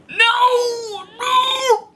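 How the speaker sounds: high, loud wail of horror; wailing refusal. Real, not acted. Reader refusing the cringy dessert scene, wailing. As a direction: cry out in agonised cringe.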